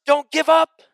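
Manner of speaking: "Don't give up" shouted high and loud; pleading shout of "Don't give up"; loud exhortation. Acted. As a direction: hammer "Don't give up" home. Repeated exhortation, built up.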